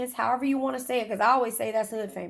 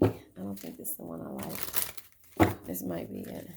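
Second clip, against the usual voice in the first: whisper; 6 dB quieter than usual. Real, not acted. Muttering to herself while choosing deck.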